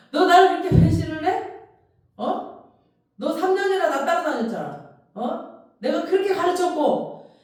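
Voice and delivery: high, loud ranting scold of a traitor; mock furious rebuke. Pastor imagines scolding Peter for betraying him, loud mock fury. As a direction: yell at him like a betrayed teacher, hamming it up. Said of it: "나 같으면".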